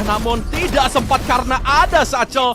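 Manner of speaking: loud, high hype on the kills; fighting game announcer. Real, not acted. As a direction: explode with excitement at the kills. Hyped kill call.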